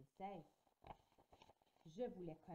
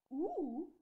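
a sudden, much louder and higher cry; 8 semitones higher, 12 dB louder than usual. Acted. Franny startling the children with a sudden loud cry. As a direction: burst out with it so everyone jumps. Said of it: "s'est écriée mademoiselle Franny tellement fort".

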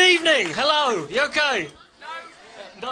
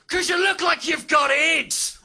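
high, loud, slow Northern Irish yell; Northern Irish heckler; 6 semitones higher, 5 dB louder, slower than usual. Retold or imitated. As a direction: drag out the insult in a shrill Northern Irish shout. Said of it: "This is what he said".

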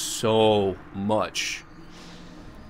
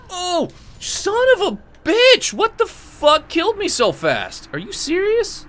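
high, loud shocked shriek of frustration; outraged yell; 5 dB louder than usual. Real, not acted. Gamer killed suddenly, yelling high in outrage. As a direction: scream at the game that just killed you.

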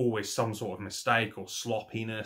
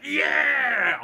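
much higher loud crowd-pop shout; excited cheer; 13 semitones higher, 8 dB louder than usual. Retold or imitated. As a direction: yell like a fan marking out. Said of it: "I popped for that".

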